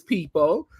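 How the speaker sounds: ordinary talk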